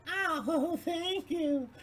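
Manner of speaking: put-on very high girly voice with a giggle; flattered falsetto reply; high, giggling woman's voice; coy woman. Woman answering the pickup line.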